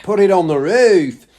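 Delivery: put-on loud, high Aussie-accented line; Australian accent. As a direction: join in with a jokey Australian accent. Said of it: "in an Australian accent".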